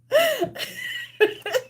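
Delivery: very high squealing laugh; high wheezy giggle. Real, not acted. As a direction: crack up helplessly. Cracks up, can't continue.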